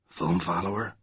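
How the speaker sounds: soft, low murmur. A narrator's reading. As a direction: murmur it softly as a hesitant question. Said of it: "he murmured".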